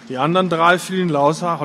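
ordinary delivery, nothing marked

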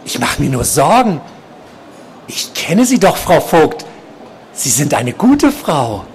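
high-pitched, insistent patient voice; creepy stalker, concerned. A narrator's reading. Obsessed patient telling nurse he worries, high insistent voice. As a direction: press concern on her in a high odd voice.

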